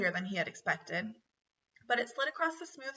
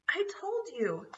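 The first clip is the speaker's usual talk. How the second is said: impatient; 9 semitones higher, slower than usual. A narrator's reading. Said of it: "said Ralph impatiently".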